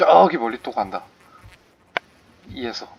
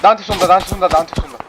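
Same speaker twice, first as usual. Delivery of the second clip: higher, louder alarmed call; alarmed shout; 7 semitones higher, 5 dB louder, much faster than usual. Real, not acted. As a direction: yell that you're being shot. Under fire in the game.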